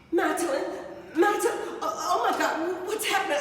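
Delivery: put-on scared for her child; mother panicking over her daughter; panicked mother.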